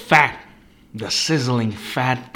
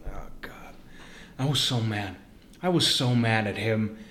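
ordinary talk

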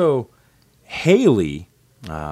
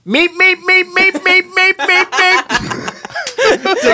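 very high, loud meep calls; calling the cat; 8 dB louder, much slower than usual. Retold or imitated. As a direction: squeak it high like a cartoon bird. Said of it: "to call the cat".

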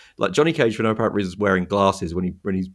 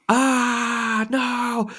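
much higher desperate wail; high wailing cry. Retold or imitated. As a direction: wail as if your soul is torn out. Souls sucked into his eyeball.

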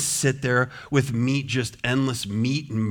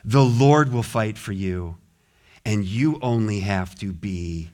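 normal talking voice

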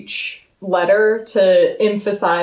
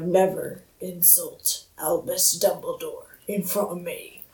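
Hagrid, quietly threatening; 3 semitones lower, 10 dB quieter than usual. Acted. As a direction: menace held down low and quiet. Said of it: "quietly threatening, but it's very, like, calmly angry".

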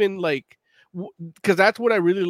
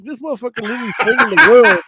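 higher, louder and fast, worked up; incredulous outburst; 4 semitones higher, 7 dB louder, faster than usual. Retold or imitated. Venting about Superman movie dad, fast incredulous outburst. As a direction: blurt it out in disbelief. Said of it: "I'm like".

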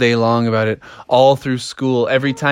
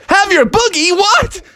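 high, incredulous mock squawk; hammed-up high-pitched shock; shocked disbelief; 3 dB louder than usual. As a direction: blurt it as if appalled. Froggy shocked by misheard offer.

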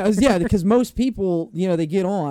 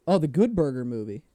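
ordinary talk

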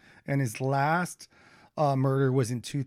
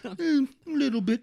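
mock Russian accent, pitched up; 10 semitones higher than usual. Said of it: "she had a Russian accent".

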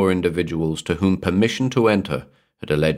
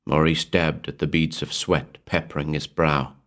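plain reading voice

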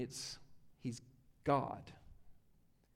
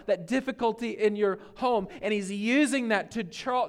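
normal talking voice

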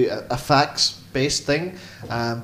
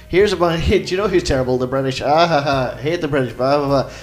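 comic's stage patter; 4 semitones higher, 6 dB louder than usual. Retold or imitated. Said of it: "He was famous for at the Delacroix".

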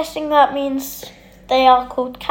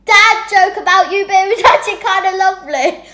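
yelling across the room; 8 semitones higher than usual. Real, not acted. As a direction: call out to the tapirs across the room. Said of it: "I just shouted across the room".

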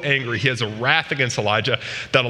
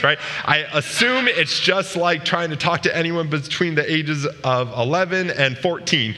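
normal talking voice

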